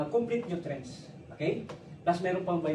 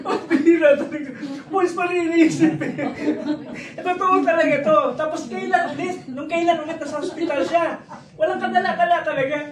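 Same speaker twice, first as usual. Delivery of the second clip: laughing; 8 semitones higher, 9 dB louder than usual. Real, not acted. Laughing at mother's stubbornness.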